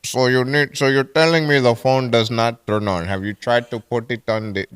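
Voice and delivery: put-on Indian-accented tech support agent; patient Indian helpdesk impression; mock Indian accent. Agent questioning caller about phone, mock Indian accent. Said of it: "I can do the Indian voice".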